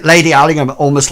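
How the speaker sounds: usual speaking voice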